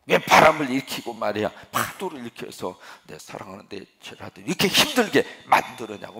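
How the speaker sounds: loud scolding rebuke; loud mock rebuke of the father; rebuking. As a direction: scold the wind and sea for troubling my disciples. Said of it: "막 꾸짖는 거랑 마찬가지라고요".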